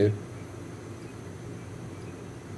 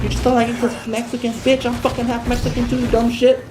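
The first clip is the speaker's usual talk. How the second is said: higher, sneering mock voice; mocking critic; 7 semitones higher than usual. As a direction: parody the people accusing him. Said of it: "according to people".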